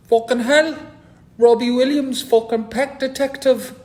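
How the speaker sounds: high, loud Geordie bloke; Geordie accent. Retold or imitated. A Geordie man at the urinal recognising him, in Geordie accent. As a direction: shout it like an excited Geordie. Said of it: "he was a Geordie".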